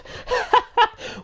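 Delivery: high amused laugh; burst of laughter. Real, not acted. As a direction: laugh out at the comment. Amused by a viewer comment.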